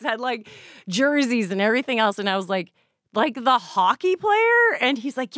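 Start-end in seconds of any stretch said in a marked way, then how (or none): 3.1-4.7 incredulous. Retold or imitated. Disbelief about Mario Lemieux.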